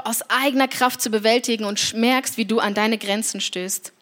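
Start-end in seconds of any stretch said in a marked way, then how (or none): none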